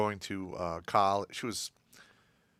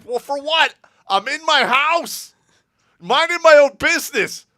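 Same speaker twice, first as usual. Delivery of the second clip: put-on indignant teen; 13 dB louder than usual. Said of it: "And I'm like".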